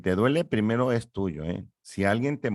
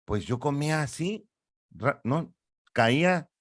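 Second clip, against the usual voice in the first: higher, playful voice of the instinct; childlike instinct voice; 6 semitones higher than usual. Acted. Ego tells instinct how it ate, higher playful character voice. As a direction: voice the baby's instinct as a little character.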